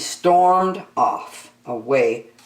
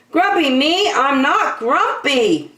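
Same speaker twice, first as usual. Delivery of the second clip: high, loud denial; insisting monkey; 11 semitones higher, 4 dB louder than usual. Acted. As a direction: Jim stamps out his protest. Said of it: "said Jim".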